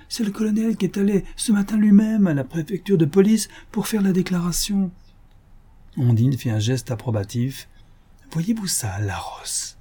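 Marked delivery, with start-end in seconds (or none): 0.1-4.9 high-pitched, dawning alarm; worked-up, high-voiced discovery; agitated realisation. A narrator's reading. Alarmed realisation about the theft. 8.3-9.8 hushed, voiceless whisper; soft whisper, trailing right down; whispered aside. A narrator's reading. Sly aside after approving gesture.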